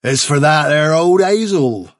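a sly country labourer explaining; the man to Fiver, sly rustic workman. Acted. Narrator voices the man.

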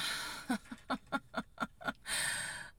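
airy, high, bemused giggle; breathy laugh. Real, not acted. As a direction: laugh out of confusion. Laughs at confusing order.

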